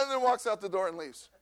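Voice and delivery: normal talking voice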